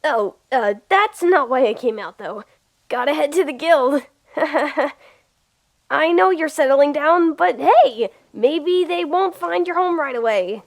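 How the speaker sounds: ordinary delivery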